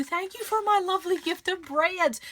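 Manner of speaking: delighted. Real, not acted. Delighted thanks.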